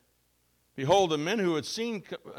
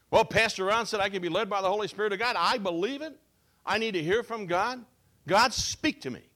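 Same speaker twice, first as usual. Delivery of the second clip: impatient believer; 6 semitones higher, 4 dB louder than usual. Retold or imitated. Congregant eagerly demanding to hear God.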